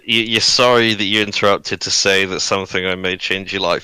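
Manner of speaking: usual speaking voice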